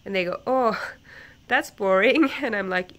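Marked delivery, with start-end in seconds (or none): 0.5-2.3 higher, louder teacher voice with a laugh; mock teacher. Imitating a teacher dismissing the book, higher and louder. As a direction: toss it off brightly, laughing.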